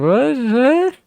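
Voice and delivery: shot up high in disbelief; a squeaky, stunned what; high startled what; incredulous what. Real, not acted. Incredulous reaction to voice comments.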